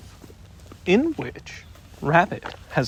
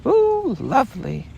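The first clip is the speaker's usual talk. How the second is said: delighted; 9 semitones higher than usual. A narrator's reading. Roo after bouncing.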